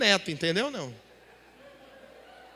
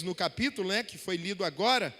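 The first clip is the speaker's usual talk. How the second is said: ordinary talk, nothing marked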